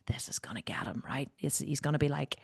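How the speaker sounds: soft, low, unvoiced aside; whispered inner thought. Wife gloating to herself, whispered. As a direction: whisper it like a smug secret. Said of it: "And I was like".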